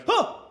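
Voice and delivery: short, high shocked gasp; startled gasp. Acted. You suddenly have a thought, startled gasp.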